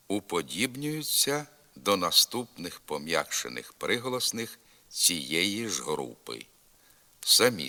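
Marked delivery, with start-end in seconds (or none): none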